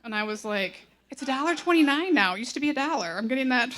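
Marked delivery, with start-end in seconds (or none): 1.1-3.7 loud, high-pitched self-defense; hammed-up protest, much louder; indignant retort. Mom answering her thirteen-year-old about pop prices, loud indignant retort.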